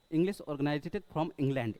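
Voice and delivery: slow, careful model sentence; teacher pacing out the answer. Shown as a demonstration. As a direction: spell it out slowly for the class. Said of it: "teacher models answer slowly".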